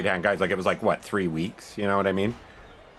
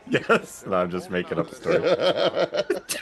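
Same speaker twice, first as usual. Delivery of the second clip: laughing; 4 semitones higher, slower than usual. Real, not acted. Admits joke while laughing.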